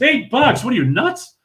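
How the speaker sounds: high-pitched, disbelieving retort; incredulous outburst. Retold or imitated. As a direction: balk at the price as if it's crazy. Balks at the price.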